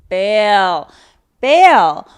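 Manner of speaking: loud, punched 'bail', each on its own; forceful one-word command on 'bail'; emphatic urging. Real, not acted. Emphatic advice to listeners.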